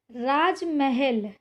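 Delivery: राजमहल said very slowly; slow dictation. Shown as a demonstration. Teacher slowly reads राजमहल to students. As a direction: draw out राजमहल syllable by syllable. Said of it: "इधर दिया गया है राजमहल".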